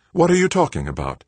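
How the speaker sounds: high, forceful demand. A narrator's reading. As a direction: press her hard for an answer. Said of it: "Joanna demanded".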